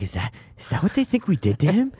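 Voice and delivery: Kiwi accent, lightly imitating the character; broad kiwi accent. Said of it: "He's like".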